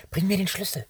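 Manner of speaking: desperate plea. A narrator's reading. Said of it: "fragte Benjamin verzweifelt".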